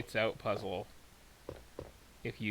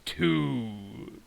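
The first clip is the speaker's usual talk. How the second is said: scary monster voice; 5 dB louder than usual. Retold or imitated. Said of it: "your scary monster voice".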